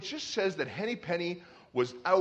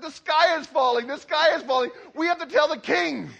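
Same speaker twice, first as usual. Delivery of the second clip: mock panic; 9 semitones higher, 7 dB louder than usual. Alarm retold to Goosey Lucy.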